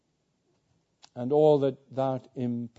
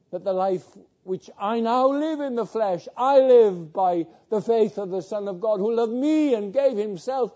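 preaching at full force; 8 semitones higher, 8 dB louder than usual. Acted. Preacher crying out Galatians to his congregation, loud and high. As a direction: ring out the verse like a revival preacher. Sermon climax reading Galatians.

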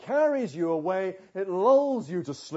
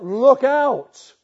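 sudden loud high warning yell; shout; 5 semitones higher, 11 dB louder than usual. Shown as a demonstration. Preacher shows the warning shout to someone crossing before a juggernaut, a loud high yell. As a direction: yell it like a truck is coming. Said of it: "You want them to shout".

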